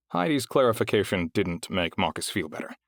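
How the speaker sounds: plain reading voice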